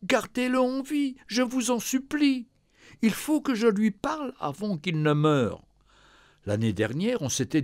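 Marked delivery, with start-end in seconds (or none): 0.0-5.6 high, pleading, tearful cries down the phone; sobbing daughter. Acted. Daughter on the phone begging the nurse, tearful and pleading. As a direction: beg the nurse through tears, voice pitched high. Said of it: "La fille éclata en sanglots".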